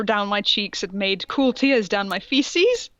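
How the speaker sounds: usual speaking voice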